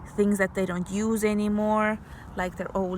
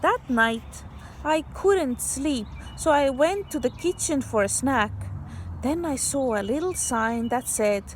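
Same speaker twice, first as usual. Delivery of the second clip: put-on bright read-aloud voice for kids; story reading; 4 semitones higher than usual. Teacher reading a story to kids, storybook voice. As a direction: read the picture book to children.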